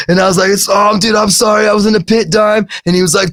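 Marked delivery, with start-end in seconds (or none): 0.5-2.7 higher, flustered excuse; sheepish apology. Retold or imitated. The teen apologizing to Dime for the joint, sheepish. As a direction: apologize like an embarrassed kid.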